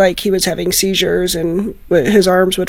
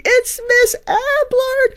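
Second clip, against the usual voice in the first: imitated excited screaming; much slower than usual. Foster mother imitating the kids spotting her at the door, mock screaming. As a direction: squeal it slowly, way up high, like thrilled kids. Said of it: "they start just screaming".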